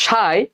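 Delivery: সাই said as "sai", nasal chandrabindu vowel; correct pronunciation. Shown as a demonstration. Teacher showing students: সাই said with a nasal vowel. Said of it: "এটার উচ্চারণ কিন্তু সাই বাংলায়".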